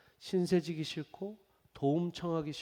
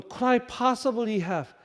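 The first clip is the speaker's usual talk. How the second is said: very slow, exaggeratedly careful English; overly cautious phrase; 7 semitones higher, 5 dB louder, much slower than usual. Pastor repeating the friend's coffee order, overly cautious. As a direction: stretch it like asking a huge favor. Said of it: "정말 너무나 조심스러운 표현을 써서".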